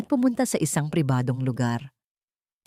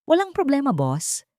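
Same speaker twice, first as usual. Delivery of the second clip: lightly voiced henchman; 9 semitones higher than usual. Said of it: "sagot ng boses sa kabilang linya".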